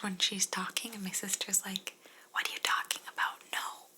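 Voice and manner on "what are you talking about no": usual speaking voice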